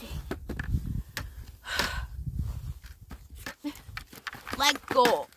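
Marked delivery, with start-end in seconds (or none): 0.4-4.5 long deflated breathy sigh; long heavy sigh. Real, not acted. Long heavy sigh after losing the biggest fish. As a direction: sigh out the disappointment.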